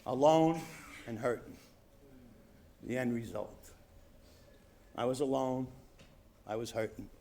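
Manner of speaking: low, soft, with long pauses; somber confession. Real, not acted. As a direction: let each short phrase fall quietly. Somber confession after the laughter.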